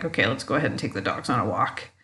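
low, quick, subdued reply; acting out a reluctant okay, softly; grudging agreement. Annoyed couple agreeing to walk.

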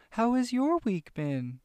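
high, slow, cheery cartoon-host voice; Dora voice. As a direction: ask slowly and high like a kids' show host. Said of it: "I feel like Dora".

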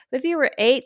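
normal talking voice

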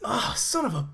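high, embarrassed disbelief; sheepish surprise. Real, not acted. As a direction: caught out and a bit sheepish. After learning he missed it.

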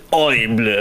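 "Horrible" said the French way, "oh-ree-bluh"; mock French "Horrible" with a rolled ending; French accent. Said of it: "you can say it's French".

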